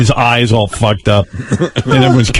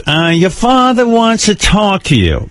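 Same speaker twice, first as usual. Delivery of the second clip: mother's voice; 9 semitones higher than usual. Retold or imitated. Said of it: "my mother goes".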